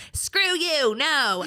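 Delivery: acted teenage outburst, high and loud; defiant outburst. Rude teen snapping at helpline worker, loud acted defiance. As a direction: throw back a loud, high refusal.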